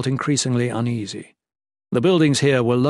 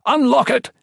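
high, loud command; urgent shout; 12 semitones higher, 5 dB louder than usual. A narrator's reading. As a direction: bark the order in panic. Urgent order during attack.

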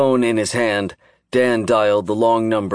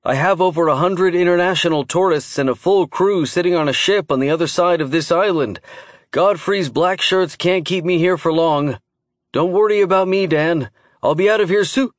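calling from far away; 8 semitones higher than usual. A narrator's reading. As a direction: call out over a failing line. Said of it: "still distant".

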